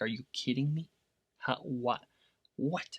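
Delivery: retold quiet disbelief, stunned little questions; baffled disbelief. Coach recalls telling sellers off for their mistake, quietly baffled. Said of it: "it boggles my mind".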